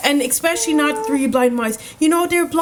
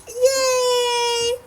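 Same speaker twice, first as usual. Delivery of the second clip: tiny high squeaky cheer; little mouse voice; 10 semitones higher than usual. Pretend. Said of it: "in a little mouse voice".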